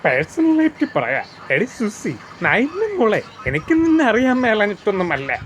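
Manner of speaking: high-pitched, emphatic film-actor impression; big dramatic actor voice, pitched well up; Lalu Alex. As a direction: declaim it high, each phrase swooping down at the end. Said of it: "Lalu അലക്സിൻ്റെ ശബ്ദം കൂടി കേൾക്കാം".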